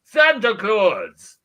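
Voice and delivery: booming jolly high Santa; big jolly Santa greeting; Santa Claus voice. Acted. Comedian plays Santa Claus.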